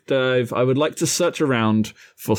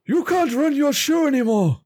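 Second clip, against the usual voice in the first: much higher, dramatic puppet voice; James breaking bad news; 11 semitones higher than usual. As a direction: deliver doom to a friend, hammed up. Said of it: "he turns to Kermit and goes".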